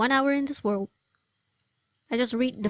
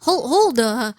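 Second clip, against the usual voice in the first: loud, startled whoa, then a louder plea to hold on; 9 dB louder, slower than usual. Real, not acted. Gamer startled by a sudden cutscene, loud whoas. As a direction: yell at the game to slow down.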